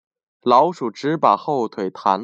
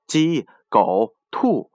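usual speaking voice

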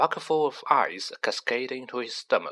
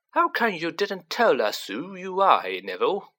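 cheerful cockney voice; 7 semitones higher than usual. Acted. Stan beams at Harry.

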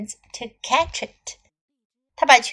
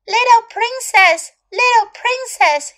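high, loud calling frog; 10 semitones higher, 6 dB louder than usual. Acted. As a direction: call sweetly through the door. Frog calls at the door.

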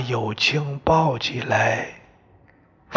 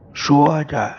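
usual speaking voice

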